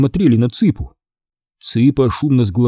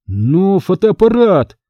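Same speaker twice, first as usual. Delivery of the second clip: slow, high plea about the camera; object, stretching it out; protesting; 7 semitones higher, slower than usual. A narrator's reading. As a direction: Greg protesting as his friends leave, slow and high. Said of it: "запротестовал Грег".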